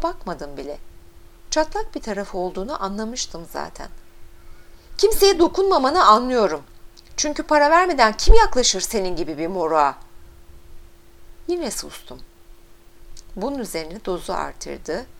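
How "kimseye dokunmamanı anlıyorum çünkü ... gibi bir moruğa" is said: loud, high-pitched scornful attack; angry taunt. A narrator's reading. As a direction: yell the insult at the driver. Angry girl keeps yelling insults. (öfkeyle, yüksek sesle aşağılama)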